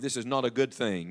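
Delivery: ordinary talk, nothing marked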